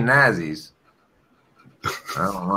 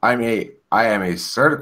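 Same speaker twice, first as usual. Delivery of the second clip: usual speaking voice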